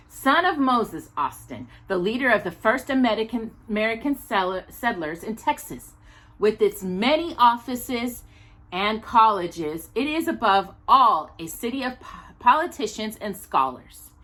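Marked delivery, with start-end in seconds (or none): none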